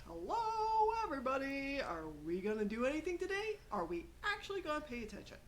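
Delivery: teacher grabbing students' attention, sing-song; sing-song teacher call. Retold or imitated. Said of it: "They're like".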